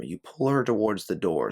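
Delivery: ordinary delivery, nothing marked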